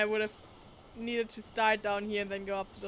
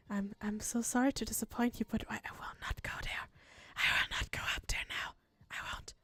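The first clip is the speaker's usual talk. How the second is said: whispering. Acted. Whispered refusal to the game.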